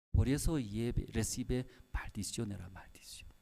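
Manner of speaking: dropped to a whisper, ominous; whispered curse. Retold or imitated. Pastor warning a seminarian in a whisper of a curse. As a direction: hiss the warning of a curse under the breath.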